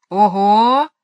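loud, drawn-out envious exclamation. A narrator's reading. Michael to his sister about the cannons in her head, envious. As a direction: let envy out in one loud "oho". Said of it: "завистливо сказал Майкл".

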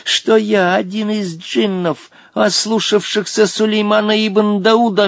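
solemn, chanting oriental-tale declamation; proud old genie. A narrator's reading. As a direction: intone your history grandly to the boy. (торжественно, нараспев, как старый джинн)

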